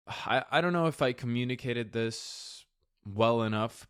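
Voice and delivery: normal talking voice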